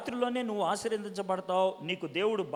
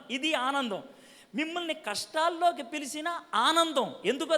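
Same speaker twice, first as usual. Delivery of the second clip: raised, louder sermon voice; fiery preaching; 5 semitones higher, 4 dB louder than usual. Real, not acted. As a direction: preach it to the crowd with rising fervour. Preaching builds to full force.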